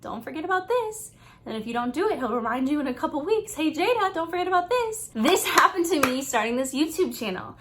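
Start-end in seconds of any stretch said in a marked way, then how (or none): 0.0-1.1 higher, softer voice for God nudging her; a light, tender nudge from above; soft gentle reminder. Retold or imitated. God reminds Jada about her task: high, soft and gentle. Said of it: "a really soft and gentle reminder". 3.6-5.1 higher, light voice for God's nudge; a kind, unhurried poke; gentle reminder. Retold or imitated. God reminds Jada again weeks later: high, gentle. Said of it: "a really soft and gentle reminder".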